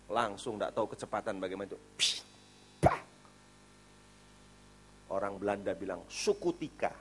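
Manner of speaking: ordinary delivery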